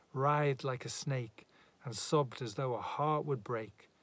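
usual speaking voice

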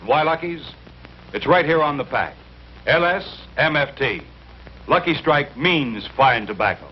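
normal talking voice

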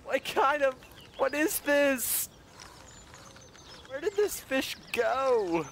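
high-pitched, drawn-out disbelief; slow, high, incredulous questioning; baffled dismay. Real, not acted. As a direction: wail the question in bewilderment. Baffled by the vanished fish.

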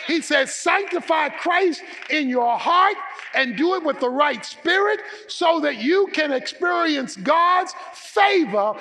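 loud, high preacher's call; preaching at full force. Acted. Pastor to congregation, preaching loud and high. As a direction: proclaim it to the congregation.

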